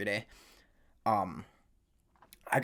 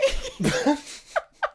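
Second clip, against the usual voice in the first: real helpless laughing, very high and loud; high burst of laughter; 5 dB louder than usual. Real, not acted. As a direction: let the laugh break out. Bursts out laughing at own story.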